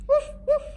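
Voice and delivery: two high, loud woofs; dog barks. A narrator's reading. Bewitched girl barks at her parents, high woofs. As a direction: yap like a small dog.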